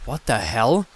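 cried out. Acted. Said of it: "I cried".

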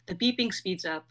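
ordinary talk, nothing marked

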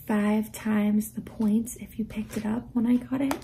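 unvoiced whisper so husband won't hear; hushed secret whisper. Real, not acted. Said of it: "I don't know why I'm whispering".

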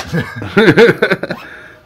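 high, loud burst of real laughter. Real, not acted. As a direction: explode into laughter. Laughs at the hot challenge.

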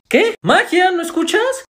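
high-pitched, incredulous repeat; mock exasperation. Acted squabble over not listening.

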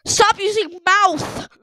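loud, high, slow demand; exasperated yell. Real, not acted. Player fed up with the screaming face, exasperated yell. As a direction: yell it slowly, word by word.

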